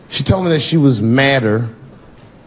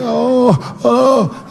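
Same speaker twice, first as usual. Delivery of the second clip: high whimpering sob; crying; 11 semitones higher than usual. Retold or imitated. Son at school, crying to dad, high sobbing whine. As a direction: imitate a little boy's crying whine.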